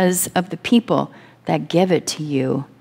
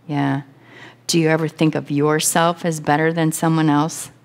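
ordinary talk, nothing marked

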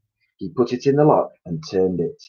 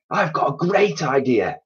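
excited cry; 7 semitones higher than usual. A narrator's reading. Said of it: "he cried".

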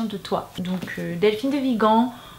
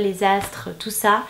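usual speaking voice

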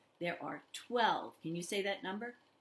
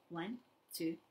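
ordinary delivery

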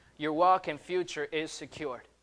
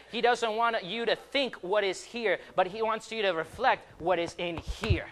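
ordinary delivery

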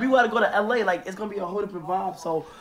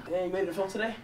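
ordinary talk, nothing marked

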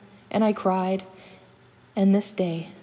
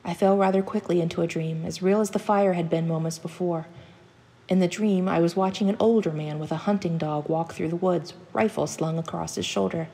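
usual speaking voice

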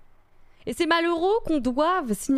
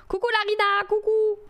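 singsong greeting; 10 semitones higher than usual. Real, not acted. Streamer greets an arriving viewer, high singsong call.

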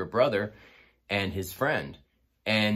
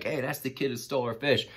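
put-on higher, playful jab at the kid; teasing call-out; 6 semitones higher than usual. To the fish thief at the party, mock-accusing tease. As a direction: make a show of calling him out. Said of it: "kept making a big deal about it".

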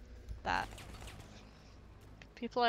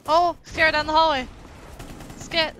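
raised, louder urgent callout; 4 semitones higher, 10 dB louder than usual. Real, not acted. Player spotting trouble down the hallway, loud callout. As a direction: call it out loudly to the team. Calling out during match.

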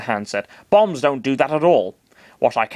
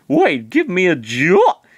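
higher, slow puzzled question; surprised Chris; 6 semitones higher, 4 dB louder, slower than usual. Acted. Chris reacts to Master Hand giving him a job, surprised. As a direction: ask it slowly, caught off guard.

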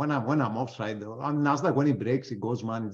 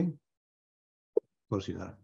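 sarcastic; 2 semitones lower, 6 dB quieter, much slower than usual. Real, not acted. Answering own question sarcastically.

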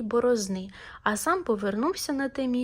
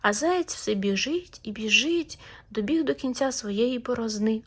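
usual speaking voice

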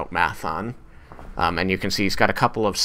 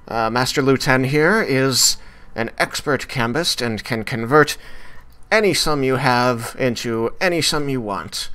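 cheerful tiefling clerk; 5 semitones higher than usual. Acted. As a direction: greet the customers brightly, pitched up. Tiefling pitches the service.

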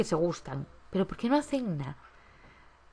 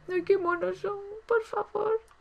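much higher, squealing over how cute they are; gushing squeal; 13 semitones higher, slower than usual. Real, not acted. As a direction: coo at a cute couple in a high voice. Gushing over cute Sims couple.

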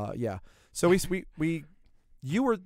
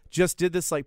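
ordinary talk, nothing marked

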